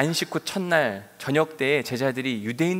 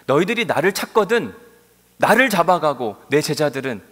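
firm command; 8 dB louder than usual. Acted. Voicing Jesus protecting disciples.

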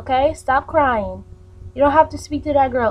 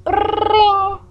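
higher ring like a phone bell; phone ring noise; 7 semitones higher than usual. Acted. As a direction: call out ring high.

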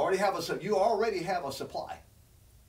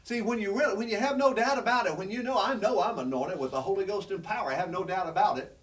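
preaching fervor; 4 semitones higher than usual. Real, not acted. Preacher exhorting the church with rising force. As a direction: press in, no doubt about it.

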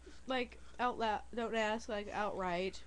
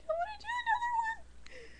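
squealing very high with excitement; excited squeal; 5 dB louder than usual. Retold or imitated. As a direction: shriek it out in a squeaky rush. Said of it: "I was like".

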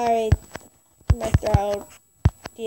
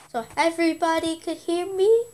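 ordinary delivery

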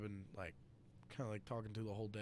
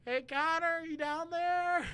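much higher, loud, slow mock mom call; 11 dB louder, slower than usual. Mom calling from the lake house to her son on the dock, high loud call. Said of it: "from the top is my mom".

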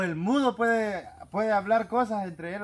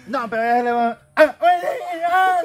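high, loud garbled yell; mock garbled shout; 8 semitones higher, 10 dB louder than usual. Retelling the cave joke, loud mock yell. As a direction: yell like the gangoso again. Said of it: "que le digo".

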